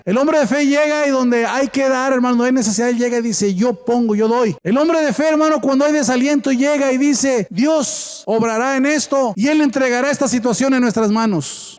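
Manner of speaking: high, driving sermon cadence; preaching. Acted. Preaches at full force. (predicando con fervor, voz aguda)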